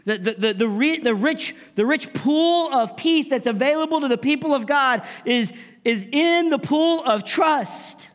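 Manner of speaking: high, loud preaching build; impassioned preaching. Acted. Pastor urging the congregation to trust, at full force. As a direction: preach it with rising fire.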